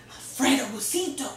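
ordinary delivery, nothing marked

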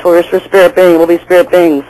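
normal talking voice

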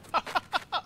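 shrill excited laugh; high squealing laugh. Real, not acted. Teammates killed, cheering.

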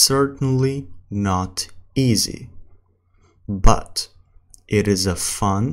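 very slow English with long pauses; slow practice reading. Shown as a demonstration. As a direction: read word by word for learners. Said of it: "السرعة الثالثة اللي هي الطبيعي".

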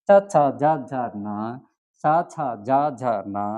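চা, ছা, যা, ঝা, না each clearly voiced; Pali consonant drill. Shown as a demonstration. Teacher repeating Pali sounds for learners, clear open vowels. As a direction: read the row as চা ছা যা ঝা না. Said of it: "উচ্চারণ".

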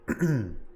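coughing after water went down wrong; throat clearing. Real, not acted. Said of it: "water went down the wrong throat".

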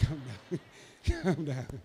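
soft, low words through chuckles; laughing reassurance. Real, not acted. Preacher calming a shocked man, laughing reassurance. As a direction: chuckle while settling someone down.

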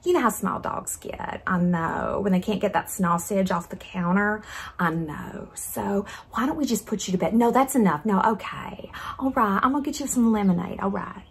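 soft, soothing Southern accent; condescending Southern babysitter, softly spoken; patronizing Southern accent. Shown as a demonstration. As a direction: settle a fussy child in a Southern accent. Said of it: "lapse into a Southern accent because that's really patronizing".

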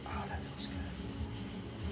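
slow breathy whisper, very soft; sexy whisper. Shown as a demonstration. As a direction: whisper it slowly, intimate. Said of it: "There's your sexy voice right there".

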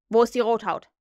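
loud, brusque question; gruff. A narrator's reading. Hook questions his men about Tigerlily, gruff demand. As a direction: demand it roughly. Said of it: "fragte er schroff".

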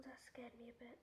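shaken hushed whisper. Real, not acted. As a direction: whisper it, still a bit scared. Whispering after a scare.

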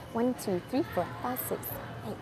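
very soft, private count; counting to herself. Real, not acted. Coach tallies the girls for groups, muttering softly to herself. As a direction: count heads under your breath. Counting heads under her breath.

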